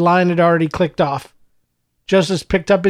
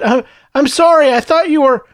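much higher, louder, embarrassed stammer; flustered stammer; 8 semitones higher, 4 dB louder than usual. Acted. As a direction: stumble over the apology in shock. Embarrassed stammer after gaffe.

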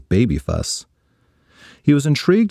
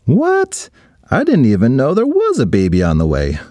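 exclaiming; 2 semitones higher than usual. Acted. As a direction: jump up in shock then rush on. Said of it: "exclaimed Ryan".

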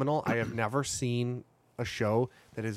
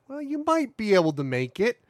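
sheriff voice; 9 semitones higher than usual. Retold or imitated. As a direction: play the sly sheriff. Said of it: "he kinda went".

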